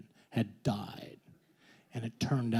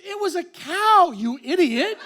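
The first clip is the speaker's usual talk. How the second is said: higher and louder, scolding; exasperated yell; 8 dB louder than usual. Retold or imitated. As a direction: snap at him like he's an idiot. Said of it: "She goes".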